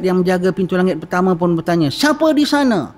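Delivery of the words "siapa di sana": high, sharp challenge from the angel guard; harsh gatekeeper. Retold or imitated. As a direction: bark it like a stern border officer. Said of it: "Amboi garangnya". (penjaga pintu yang garang)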